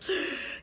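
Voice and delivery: sharp breathless gasp; Adam's sudden inhaled gasp; first gasp of life. Retold or imitated. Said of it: "and Adam goes".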